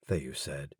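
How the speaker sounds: plain reading voice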